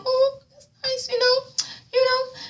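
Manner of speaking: high, slow mock-sweet voice; mock sweet reaction. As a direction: coo it sarcastically, way up high.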